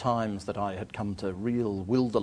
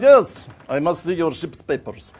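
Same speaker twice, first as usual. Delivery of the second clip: higher, louder officious voice; Bulgarian harbour master; 6 semitones higher, 10 dB louder than usual. Acted. Border official demanding papers from a traveller, officious. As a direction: insist firmly as a foreign border official. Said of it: "And he went".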